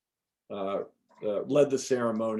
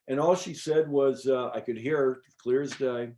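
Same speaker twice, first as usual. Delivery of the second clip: ordinary delivery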